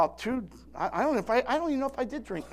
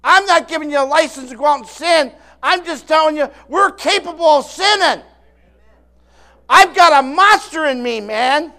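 louder and louder, higher pitched, to a near-shout on monster; fiery preaching; 6 semitones higher, 10 dB louder than usual. Real, not acted. Preacher warns the congregation they can still sin, rising to a shout. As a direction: build from firm warning into a full pulpit roar.

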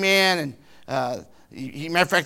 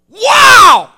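joyful exclamation; 21 dB louder than usual. Retold or imitated. Joyful realization at the funeral.

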